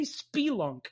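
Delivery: "spelunk" with a long ee, SPEE-lunk; "spelunk" opening on a clear "spee"; Jersey pronunciation, jokingly claimed. Shown as a demonstration. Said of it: "This is how we talk in Jersey".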